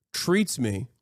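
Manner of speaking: ordinary talk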